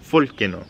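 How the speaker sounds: Volcano said as "folcano", the V turned into an F. Shown as a demonstration. Teacher shows the F-for-V variant, Volcano as "folcano". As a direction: say Volcano with an F sound at the start. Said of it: "Some people pronounce the V like an F".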